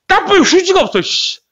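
high, exasperated outburst; mock panic. Teacher reliving finding no toilet paper, high mock-panicked outburst. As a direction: jump up in pitch with comic dismay.

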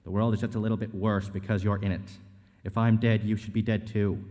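forceful, accusing script read; hard-driving acted argument; heated confrontation. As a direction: tear into them in a tense showdown. Acted graveside confrontation in script reading.

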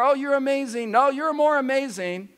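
high, sing-song mock sweet talk; mock lovey-dovey couple. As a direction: tease a gushing couple. Mocking lovey-dovey couple banter.

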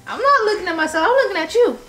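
high, quick protest. Real, not acted. Friend answering an accusation, high protesting. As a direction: push back fast and high.

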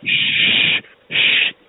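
long hissed shush; drawn-out "shh" to silence the listener; urgent shushing. Pretend. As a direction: shush someone repeatedly. Caller shushes the clerk.

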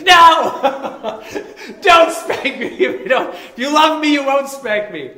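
high, loud pleading shout; a kid begging mom not to spank him; mock childish yell, laughing; child's protest. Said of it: "And I'm like".

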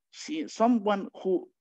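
ordinary talk

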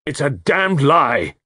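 high, drawn-out angry denial; crying out. A narrator's reading. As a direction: cry out slowly, leaning hard on the curse. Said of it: "cried Carter".

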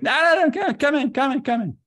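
high, loud fussy insisting voice; Larry David impression. Larry David impression, high and loud, waving the storyteller in. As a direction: flap the words out high and urgent. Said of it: "He's like".

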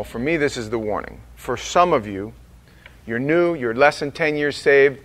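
usual speaking voice

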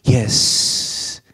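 slow, breathy spoken intro; lightly imitating his spoken jazz intro; jazz club intro voice. Reviewer imitating the song's spoken opening, slow and hushed. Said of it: "the way he's talking at the very beginning".

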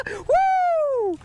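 very high laughing shout; excited whoop. Real, not acted. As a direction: whoop with glee. Fish landed in the net.

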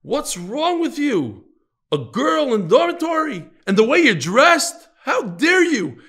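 high, loud outraged yelling, slow at first; scolding scream. Retold or imitated. Rebbe yelling at the yeshiva boys, outraged scream. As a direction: bellow it like a furious rebbe catching a scandal. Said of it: "So he starts screaming".

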